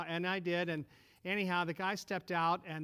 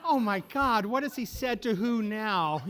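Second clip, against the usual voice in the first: higher, louder mock alarm; exasperated wife gasping at the news; 4 semitones higher, 7 dB louder, slower than usual. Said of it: "She's like".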